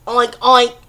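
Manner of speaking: loud imitated pig oinks. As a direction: grunt it out like a pig.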